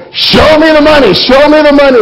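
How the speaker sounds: high, loud shouted chant; yelling back and forth. Retold or imitated. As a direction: yell it over the phone. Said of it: "both yelling back and forth".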